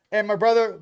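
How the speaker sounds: ordinary talk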